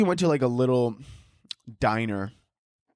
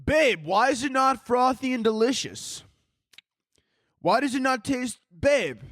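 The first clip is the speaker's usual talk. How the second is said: higher, whiny mock boyfriend; 10 semitones higher than usual. Boyfriend whining to his girlfriend about seasonal taste, higher. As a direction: whine at babe like a let-down boyfriend.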